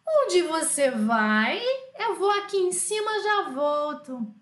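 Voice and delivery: drawn-out, sing-out exercise sentence; slow dictation. Shown as a demonstration. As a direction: stretch the question and answer for learners. Teacher dictates sentence to translate.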